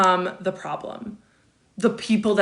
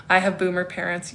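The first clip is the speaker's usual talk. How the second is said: usual speaking voice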